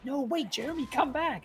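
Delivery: high, pleading character voice; acted desperate plea; Evan. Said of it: "And so Evan's like".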